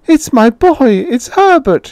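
put-on very high, loud old woman's cry; frantic cry. A narrator's reading. Mother struggling to reach her son at the door, frantic cry. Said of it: "she cried, struggling mechanically".

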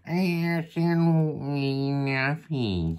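slow, drawn-out cat voice; slow feline purr of a line. Acted. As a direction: stretch it out like a cat, dropping at the end. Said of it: "I'll do a cat voice".

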